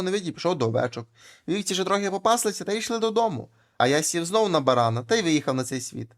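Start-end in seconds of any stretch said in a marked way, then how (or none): none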